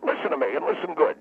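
high, indignant outburst; wound up. Acted. Guest spelling out his story to doubters, wound up. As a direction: lecture them with the pitch leaping up. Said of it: "Will you calm down".

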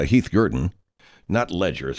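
ordinary talk

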